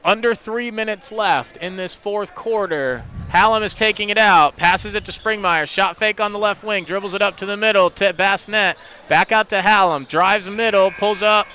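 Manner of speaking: fast, rising live game call; play-by-play. Acted. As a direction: call the action as it happens.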